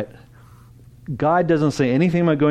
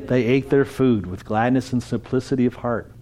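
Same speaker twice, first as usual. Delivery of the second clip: normal talking voice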